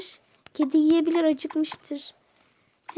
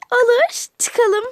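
much higher, louder cheerful agreement; excited loud yes; eager yes; 8 semitones higher, 7 dB louder, slower than usual. Acted. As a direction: jump at the offer. Girls agree to go out.

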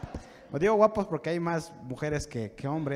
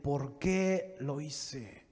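very slow, low mock-weeping question; understated lament; 3 semitones lower, 6 dB quieter, much slower than usual. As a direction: drag out the words with mild regret. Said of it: "llorar y decir". (lamento contenido, muy lento y grave)

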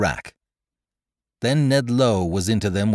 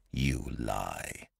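low, soft, cold accusation; deep, hushed anger; quiet anger; 10 semitones lower, 13 dB quieter than usual. Acted. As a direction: accuse him angrily under the voice. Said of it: "I said angrily".

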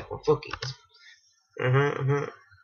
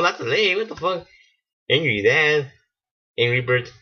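ordinary talk, nothing marked

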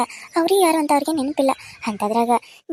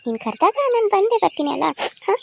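normal talking voice

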